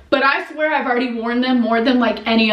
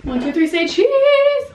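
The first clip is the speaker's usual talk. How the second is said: high sing-song photo call; bright high say-cheese call; photo countdown; 12 semitones higher, 3 dB louder than usual. Real, not acted. Posing for a thumbnail photo.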